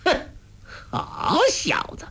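put-on a scornful hiss of good boy; sneering scoff. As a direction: sneer at the boy's nerve. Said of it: "欧阳锋哼了一声".

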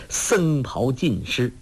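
ordinary delivery

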